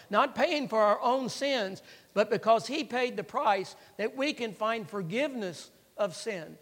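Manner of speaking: ringing gospel proclamation; forceful preaching. Real, not acted. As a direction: drive each phrase home to the congregation.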